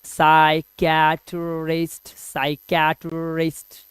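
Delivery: Psychiatrist stretched slowly, syllable by syllable; slow, clear teaching repetition of psychiatrist. Shown as a demonstration. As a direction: spell out psychiatrist slowly for students. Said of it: "इसको धीरे धीरे pronounce करेंगे".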